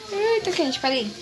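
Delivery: talking around a hot bite; words through a hot mouthful; mouth full of hot food. Real, not acted.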